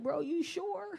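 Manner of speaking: high, incredulous check; doubtful question. Acted. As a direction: ask it high, not believing him. Acting doubt at his devotion.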